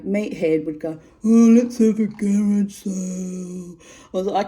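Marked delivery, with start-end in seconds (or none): 1.2-3.8 slow, louder put-on voice of the husband; mock him, stretching it out loud. Wife mimicking her husband proposing a garage sale, mocking.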